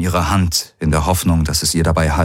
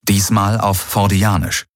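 ordinary delivery

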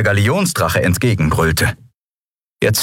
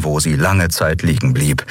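plain reading voice

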